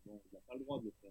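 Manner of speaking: cutting out on a bad Skype line; faint, rushed and breaking up; frozen video call. Real, not acted. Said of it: "je vous entends plus".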